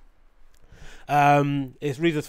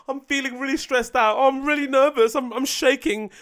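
higher-pitched, mock overwrought girlfriend; emotional girlfriend; 7 semitones higher than usual. Comic mimicking a girlfriend getting emotional, mock overwrought. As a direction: put on a high, stressed-out fretting voice. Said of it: "gets emotional".

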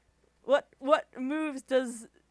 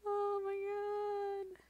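awed hush; 9 semitones higher, 9 dB quieter, much slower than usual. Real, not acted. Disbelief at the moveset.

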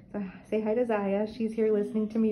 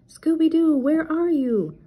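loud, high, slow call; 9 semitones higher, 8 dB louder, slower than usual. A narrator's reading. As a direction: call across the farm for him. Searching for lost dog.

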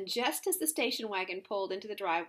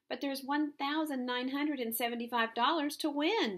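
higher, eager objection; excited protest; 6 semitones higher, 3 dB louder than usual. A narrator's reading. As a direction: protest with mounting amazement. Protests the prize's sabotage.

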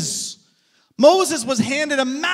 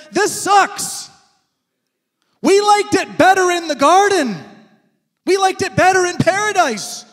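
whiny complaint; 6 semitones higher, 4 dB louder than usual. Retold or imitated. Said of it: "they said".

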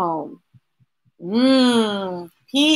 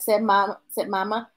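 ordinary delivery

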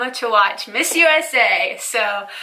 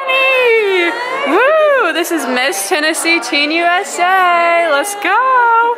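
cheering; 6 semitones higher, 5 dB louder, much slower than usual. Real, not acted. Cheering at a send-off party.

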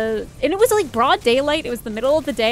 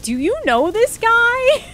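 much higher, slow disbelief; incredulous; 11 semitones higher, 5 dB louder, slower than usual. Retold or imitated. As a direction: ask it in total disbelief. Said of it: "we're like".

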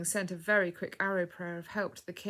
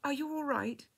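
high, timid question; tentative and a little scared; 7 semitones higher than usual. A narrator's reading. Young girl asking through the curtain, timidly. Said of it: "sounded scared and timid".

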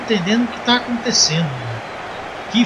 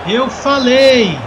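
play-by-play commentary; slower than usual. Acted. Live football play-by-play.

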